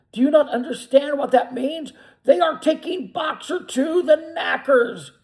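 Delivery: high, loud, urgent warning; angry shout. A narrator's reading. Benjamin telling the animals Boxer's fate, shouting. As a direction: hammer home the horror.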